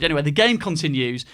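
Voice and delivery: normal talking voice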